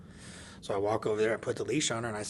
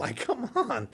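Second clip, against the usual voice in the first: slow, much higher, laughing come on; laughing disbelief; 12 semitones higher, slower than usual. Real, not acted. Amused at daughter's complaint.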